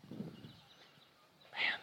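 a breathed, voiceless man; awed whisper. Real, not acted. Pastor struck by Jesus touching the leper, whispered. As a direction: whisper it in wonder.

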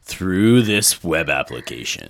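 lightly voiced trailer announcer; movie trailer voice. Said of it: "Hold on to your butts".

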